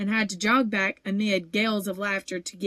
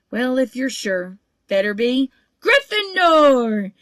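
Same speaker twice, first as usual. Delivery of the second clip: slow, building to a loud, much higher shout; shouted decision; 4 semitones higher, 7 dB louder, slower than usual. A narrator's reading. Sorting Hat makes up its mind and shouts to the hall. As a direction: start musing, then cry out to the hall. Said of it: "the hat shout the last word".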